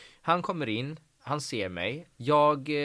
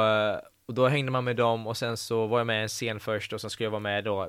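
normal talking voice

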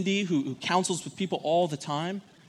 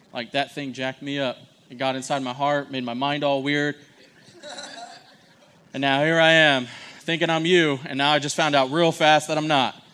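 loud mock confession; 7 dB louder than usual. Voicing Eve's confession loudly.

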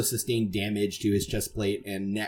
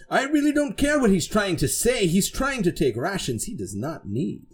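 higher-pitched, self-important knight with swooping tune; haughty boastful knight; 9 semitones higher than usual. Acted. Knight protesting to a crewmate over rations, haughty. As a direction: proclaim it like a pompous knight. Voices Sir Braddock.

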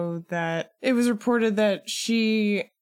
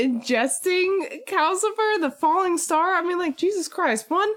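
high, rising disbelief; excited; 11 semitones higher than usual. Real, not acted. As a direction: gush with amazement. Excited outburst about scene.

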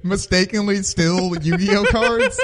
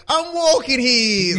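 high, slow New York yell, hammed up; mock an indignant New Yorker in a thick accent; brash New Yorker; 7 semitones higher, slower than usual. Cohost mocking rude New Yorkers, brash New York accent. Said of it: "everyone's a fucking asshole in New York".

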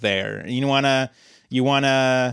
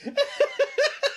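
high burst of laughter; 5 dB quieter than usual. Real, not acted. Laughing at own joke.

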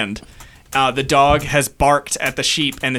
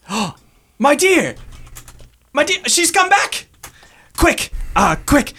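put-on high, eager old shepherd voice; excited shepherd. Shepherd seeing his dog come back, high and eager. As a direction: cry out in joy as the shepherd. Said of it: "And he goes".

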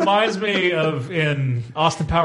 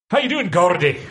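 Scottish greeting; 4 semitones higher than usual. Shown as a demonstration. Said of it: "Like that".

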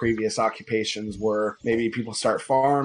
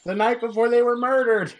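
put-on little boy voice; 12 semitones higher, 4 dB louder, faster than usual. Boy Rufus answers.

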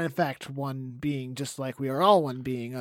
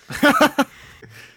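sudden high laugh; high burst of laughter; 11 semitones higher, 9 dB louder than usual. Real, not acted. Laughs at so wise.

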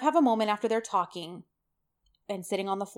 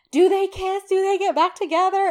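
much higher and louder, eager questions; eager pleading; 11 semitones higher, 8 dB louder than usual. Retold or imitated. Begging her friend for spoilers, excited and much higher. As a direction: beg for the spoiler.

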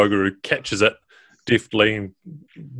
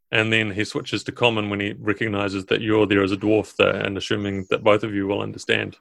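ordinary delivery

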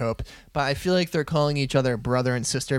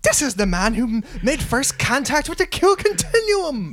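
mock awe; 8 semitones higher than usual. Mock reverent praise of Picard.

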